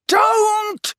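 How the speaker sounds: very high, demented scream. A narrator's reading. Snape erupts at Harry's insult, screaming. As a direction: scream as if in agony. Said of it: "screamed Snape".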